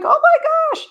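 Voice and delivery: high, loud alarmed cry; panicked oh my gosh. Retold or imitated. Parent catching an anxious teen's panic, high alarmed cry. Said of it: "you might feel like".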